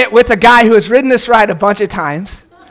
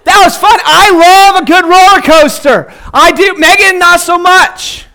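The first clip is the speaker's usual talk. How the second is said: excited; 7 semitones higher, 4 dB louder, slower than usual. Real, not acted. Right after the pretend ride.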